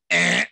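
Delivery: abrupt wrong-answer buzzer; mock buzzer noise. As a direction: imitate a game-show buzzer rejecting an answer. Imitates game buzzer rejecting answer.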